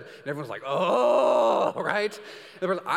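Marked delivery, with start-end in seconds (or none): 0.7-1.7 loud, high mock groan; exasperated groan. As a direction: groan as if someone asked the wrong question. Guests groaning at the question.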